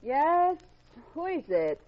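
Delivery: sharp, high, loud "Yes?"; startled call. Acted. Woman woken by the bell calls out, startled. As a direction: answer the door at night, startled.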